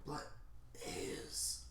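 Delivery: barely audible, breath-only croak; acted feeble whisper; dying gasp. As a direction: let the name escape on a failing breath. Dying hero answers weakly.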